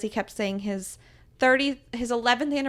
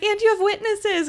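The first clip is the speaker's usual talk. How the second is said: excited teasing; 12 semitones higher, 6 dB louder than usual. Retold or imitated. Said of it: "I was like".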